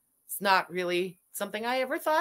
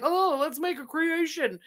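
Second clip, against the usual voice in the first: put-on 1 stoned teen; 5 semitones higher than usual. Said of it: "and I'm like".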